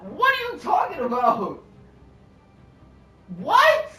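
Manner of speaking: slow build into a very high, loud what; shocked disbelief. Retold or imitated. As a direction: stretch it out, then shriek the last what. Retold disbelief.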